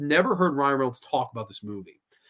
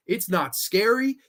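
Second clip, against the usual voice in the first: ordinary talk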